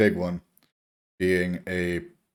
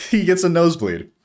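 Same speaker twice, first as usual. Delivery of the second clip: ordinary talk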